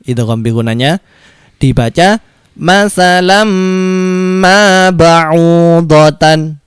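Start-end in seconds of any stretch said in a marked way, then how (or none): 2.6-6.6 slow recited "masalam ma ba'udotan" with a humming mim; drawn-out "ma ba'udotan" with a buzzing m; nasal mim merge. Shown as a demonstration. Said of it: "Bagaimana cara bacanya?".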